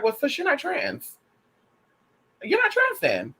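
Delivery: high, pointed well sis; sassy put-down. Retold or imitated. Vlogger scolds someone who accepts being misgendered, high and sassy. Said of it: "I'm kinda like".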